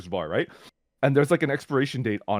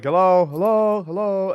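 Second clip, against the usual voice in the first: calling out; 8 semitones higher, 5 dB louder, slower than usual. Retold or imitated. Calling into empty shop.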